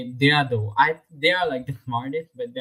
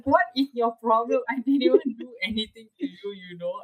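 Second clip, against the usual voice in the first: high-pitched fed-up reply to the bully; high indignant outburst; 10 semitones higher than usual. Retold or imitated. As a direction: squeal out the outrage at the bully.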